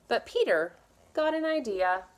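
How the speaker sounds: ordinary delivery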